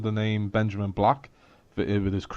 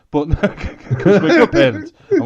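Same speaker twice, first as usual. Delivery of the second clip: laughing; 13 semitones higher, 9 dB louder than usual. Real, not acted. Laughing at own joke on parenting.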